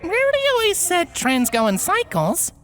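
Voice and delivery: a hint of a gentle spirit; lightly voiced gentle ghost. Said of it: "said a more gentle voice".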